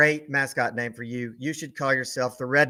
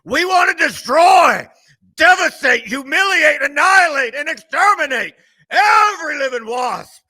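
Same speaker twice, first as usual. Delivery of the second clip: much higher, much louder shouted war cry; furious shout; 11 semitones higher, 10 dB louder than usual. Real, not acted. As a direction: roar every word like a battle cry. Rival hate-week rant climax.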